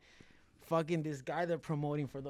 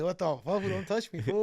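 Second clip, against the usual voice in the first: high, raised, joking recoil; mock alarm; 6 semitones higher, 7 dB louder than usual. Fighter jokingly recoils from company boss, mock alarmed. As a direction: play startled and put off, pitched up. Acting his startled reaction to CEO.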